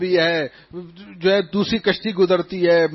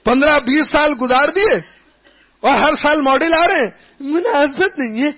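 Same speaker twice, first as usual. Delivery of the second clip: indignant; 6 semitones higher, 3 dB louder than usual. Real, not acted. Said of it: "indignant mock retort".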